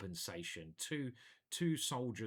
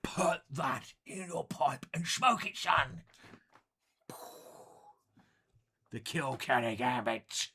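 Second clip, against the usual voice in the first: gruff swaggering pirate, louder; 4 semitones higher, 7 dB louder than usual. Pretend. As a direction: growl it like a pirate. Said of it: "I sound like a pirate".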